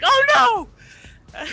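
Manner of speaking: high loud cry of panic; panicked scream. Acted. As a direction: shriek as the blade drops.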